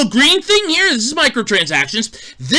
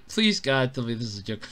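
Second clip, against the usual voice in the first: much lower and softer, a deflated plea; mock despairing plea; 13 semitones lower, 12 dB quieter than usual. Real, not acted. Begging God that the game is a joke, a hushed plea. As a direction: plead under your breath.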